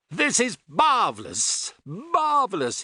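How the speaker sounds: high, gleeful exclamation, drawn out; delighted cry. A narrator's reading. The Doctor splashing in the shallows, crying out with delight. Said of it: "he cried".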